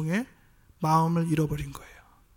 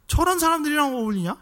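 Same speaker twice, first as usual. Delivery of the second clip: high, scornful jeer at the company he keeps; a higher mocking retort; mocking sneer; 8 semitones higher than usual. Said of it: "라고 비웃었어요".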